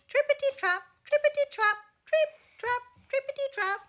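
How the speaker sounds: high, loud rhythmic trotting chant; bright staccato clatter of hooves; clattering hoof sounds. A narrator's reading. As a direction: patter out hoofbeats high and loud. Middle goat clattering over bridge.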